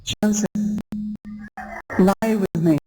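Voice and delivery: seductive plea. Acted. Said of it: "You can just imagine".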